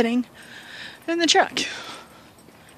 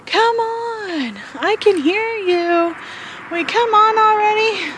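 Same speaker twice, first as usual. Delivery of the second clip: put-on high, loud child's hollering; retold kid screaming; 9 semitones higher, 3 dB louder, slower than usual. Little grandson yelling from the truck for grandma to come on. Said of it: "their little grandson is outside screaming".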